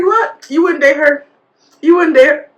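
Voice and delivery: high, loud incredulous questioning; mock disbelief. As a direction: press her in retold astonishment. Retold teasing shock at her refusal.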